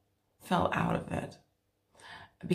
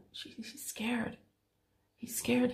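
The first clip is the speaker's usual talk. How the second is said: put-on slow, hushed whisper of a frightened child's feeling; scared whisper; 5 semitones higher, 8 dB quieter, slower than usual. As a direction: breathe it out barely voiced. Client answers as little boy.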